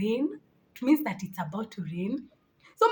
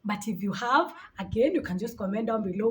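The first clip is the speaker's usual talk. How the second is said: usual speaking voice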